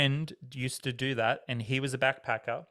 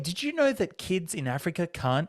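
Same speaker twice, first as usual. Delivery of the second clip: much higher, bright salesy hailing voice; chirpy fundraiser; 6 semitones higher, 4 dB louder than usual. Retold or imitated. Mall fundraiser calling out to a passing shopper, overly chirpy. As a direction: hail a shopper with fake friendliness.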